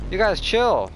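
loud call for everyone to chill. Real, not acted. Telling arguing friends to calm down.

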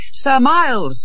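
put-on high-pitched boy's call; crying out. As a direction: call urgently to a rescuer. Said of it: "he cried".

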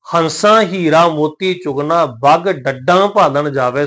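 slow verse reading, nasal ending on ਡੱਡਾਂ; measured recitation, nasalise the last vowel of ਡੱਡਾਂ; nasalised ਡੱਡਾਂ. Shown as a demonstration. Teacher reading scripture to congregation, nasalised ਡੱਡਾਂ. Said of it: "ਬਿੰਦੀ ਸਹਿਤ ਉਚਾਰਨ ਕਰਨਾ ਪਏਗਾ".

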